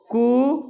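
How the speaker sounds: कु with the vowel held for two beats; two-beat rooster call. Shown as a demonstration. Teacher imitating a rooster's crow for students, two-beat कु. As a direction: lengthen the उ of कु moderately. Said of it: "ये दो मात्रा काल का".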